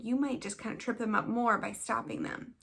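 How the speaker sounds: normal talking voice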